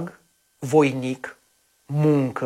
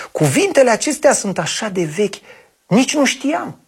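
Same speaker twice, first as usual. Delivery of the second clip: delighted; 6 semitones higher than usual. Acted. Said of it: "exclamă încântată fata".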